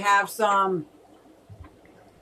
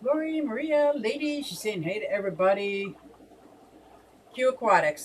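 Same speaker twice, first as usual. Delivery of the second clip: normal talking voice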